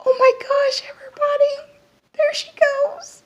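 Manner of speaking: very high, near-crying squeal of excitement; thrilled squeal. Real, not acted. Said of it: "I'm so excited".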